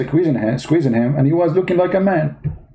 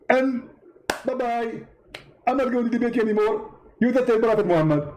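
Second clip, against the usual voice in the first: high, triumphant preacher voice; Zakir Naik gloating; 8 semitones higher than usual. Acted. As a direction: crow over the win, pitched well up. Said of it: "Zakir Naik?".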